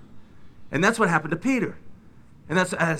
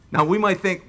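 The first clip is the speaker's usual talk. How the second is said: ordinary delivery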